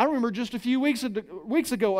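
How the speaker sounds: usual speaking voice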